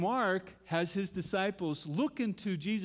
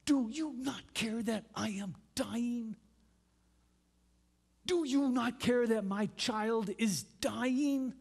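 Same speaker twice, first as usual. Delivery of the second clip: screaming at God; 5 semitones higher, slower than usual. Retold or imitated. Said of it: "wanted to scream at God".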